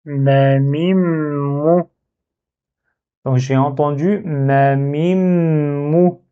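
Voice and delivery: Ma mim mou spaced out very slowly, syllable by syllable, the m of mim held into mou; slow syllable dictation. Shown as a demonstration. Teacher dictating a new word to learners, very slow and spaced. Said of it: "Je vérifie".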